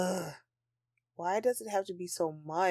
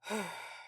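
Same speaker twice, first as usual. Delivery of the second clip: deflated sigh; 7 dB quieter than usual. Acted. Sigh after rant.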